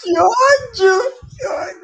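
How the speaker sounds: high, wailing frustration; frustrated cry. Real, not acted. Reaction after falling live.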